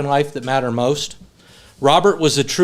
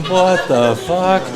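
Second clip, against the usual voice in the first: slow, drawn-out, higher bewilderment; retold disbelief; 4 semitones higher, much slower than usual. Friend stunned by the honeymoon joke, drawn-out disbelief. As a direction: stretch every word in mock shock.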